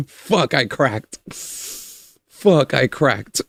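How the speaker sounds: losing control to real laughter; cracking up. Real, not acted. Streamer admitting they cracked mid-rant, helplessly laughing. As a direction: swear helplessly through a fit of laughing. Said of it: "I lost control".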